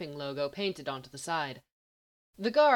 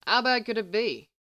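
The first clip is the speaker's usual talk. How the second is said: higher, louder drawling character voice; 6 semitones higher, 5 dB louder than usual. A narrator's reading. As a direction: drawl the question out at someone. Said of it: "Lawton asked in a drawl".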